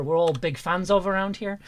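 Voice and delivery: ordinary delivery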